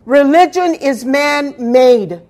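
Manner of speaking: full-force pulpit preaching; forceful preaching. Acted. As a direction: preach it out to the whole congregation.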